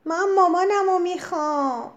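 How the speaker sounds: put-on spoiled child yelling; shouting. A narrator's reading. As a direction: cry out for mommy in a tantrum. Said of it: "شاهزاده خانم فریاد زد".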